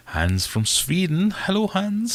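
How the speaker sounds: high, sing-song mock Swedish accent; terrible Swedish accent. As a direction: greet him in a badly faked Swedish accent. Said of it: "Sorry about the accent".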